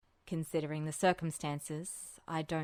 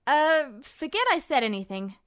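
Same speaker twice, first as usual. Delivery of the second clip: put-on higher, louder defensive young guy; young man voice; 9 semitones higher, 10 dB louder than usual. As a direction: brush it off, loud and forced casual. Ed backpedals after slip.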